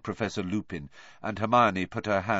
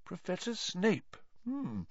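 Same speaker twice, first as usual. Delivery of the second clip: soft, higher musing teacher; thoughtful; 10 semitones higher, 8 dB quieter than usual. A narrator's reading. Teacher pondering Neville's fear, soft and thoughtful. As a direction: turn the name over in your head. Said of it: "looked thoughtful".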